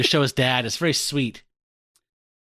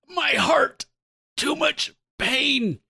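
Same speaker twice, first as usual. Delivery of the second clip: high, drawn-out mock agony; hammed-up heart attack; 11 semitones higher, much slower than usual. Smythe seized by chest pains, hammed-up high cry. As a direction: clutch your chest and overplay the pain. Villain clutching his chest.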